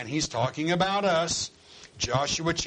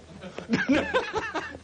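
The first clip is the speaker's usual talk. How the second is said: squeaky high laugh. Real, not acted. Preacher amused at the apostles, laughing high.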